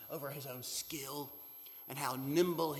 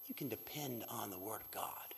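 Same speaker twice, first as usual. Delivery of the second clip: whisper; 6 semitones lower, 10 dB quieter than usual. Acted. Preacher hushes after a pause.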